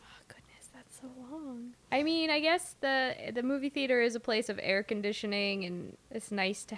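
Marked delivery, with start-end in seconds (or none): 0.0-1.7 whispering. Real, not acted. Whispered reaction to length.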